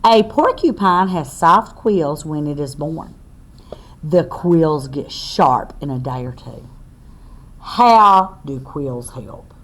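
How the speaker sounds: slow, loud read-aloud to a class; reading to children. Acted. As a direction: spell it out clearly for little listeners. Reads book text aloud.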